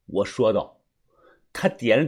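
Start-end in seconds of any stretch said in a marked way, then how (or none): none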